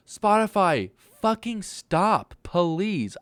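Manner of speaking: loud, high, slowed-down plea; exasperated yell. Real, not acted. Yelling at Spotify for shuffling songs, loud and exasperated. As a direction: snap at the app to stop.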